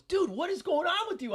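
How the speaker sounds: 高八度模仿妻子的质问; 用尖高的假声学妻子发问; imitating wife. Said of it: "My wife goes".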